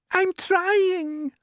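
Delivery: high, anxious small voice; poor glowworm. Acted. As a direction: strain to please, voice up high. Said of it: "answered the poor glowworm".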